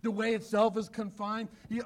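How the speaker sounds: normal talking voice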